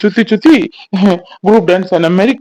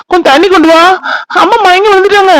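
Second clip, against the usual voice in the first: urgent shout; 10 semitones higher, 4 dB louder than usual. Acted. Mother fainted, calls for water.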